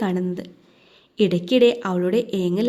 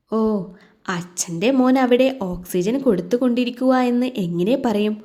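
usual speaking voice

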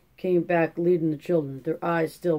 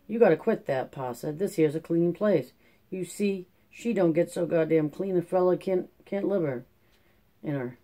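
ordinary delivery

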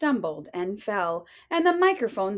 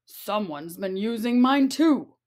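Papa Bear voice. Acted. Papa Bear finds mic stand.

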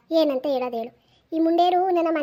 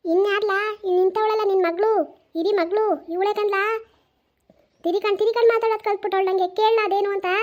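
accusing grandmother; 4 semitones higher than usual. Acted.

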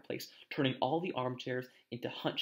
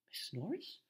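very soft, higher-pitched question; breathed; 5 semitones higher, 10 dB quieter than usual. A narrator's reading. Ron fears the caretaker's cat is near, breathed. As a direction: barely voice it, hushed and wary. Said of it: "breathed Ron".

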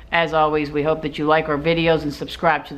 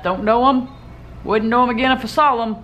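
playful; 6 semitones higher than usual. Real, not acted. Playful aside about the couple.

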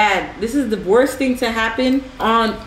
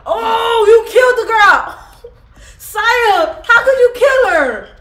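high, loud shocked yelling; shocked shout; 11 semitones higher, 6 dB louder, slower than usual. Real, not acted. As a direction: shout it in horror at your friend. Shock at dead girl in game.

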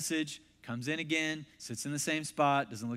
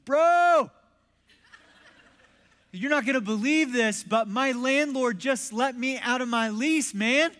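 high, loud outburst of good news; big excited retold shout; excited friend; 8 semitones higher, 8 dB louder than usual. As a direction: burst out, imitating a thrilled buddy.

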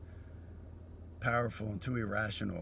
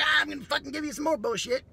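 much higher, louder sneering taunt; sociopath troll voice; 8 dB louder than usual. Retold or imitated. Sociopath troll vowing more abuse, high sneering taunt. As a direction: taunt like a gleeful internet troll. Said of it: "and the, like".

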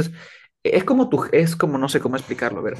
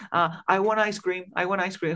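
put-on childish demand; 5 semitones higher than usual. Son asks his parent for ice cream, childish and higher. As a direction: pester like a child who wants a treat. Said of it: "si yo soy tu hijo".

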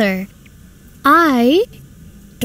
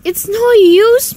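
exasperated protest; 8 semitones higher, 5 dB louder, slower than usual. Acted. Daughter protests mother's matchmaking.

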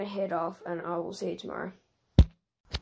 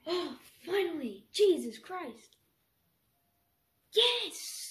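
high, elated cry of triumph; ecstatic shout; 6 semitones higher, much slower than usual. Real, not acted. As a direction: yell it out in triumph. Celebrating the landed flip.

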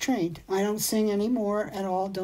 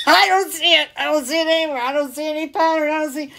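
raised, louder protest, insisting over and over; playful complaint pitched higher and louder; mock exasperation; 7 semitones higher, 6 dB louder, faster than usual. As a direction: act baffled and a little indignant at the website. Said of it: "And I'm like".

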